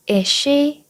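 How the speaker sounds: "Is she" with a single S, linked as "I-she"; one S sound. Shown as a demonstration. Teacher showing students to say "Is she" with one S. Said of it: "S를 한 번만 발음해주시면 돼요".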